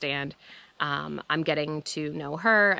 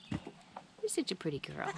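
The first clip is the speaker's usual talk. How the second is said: whispering; 2 semitones higher, 12 dB quieter than usual. Real, not acted. Cooing to the goat.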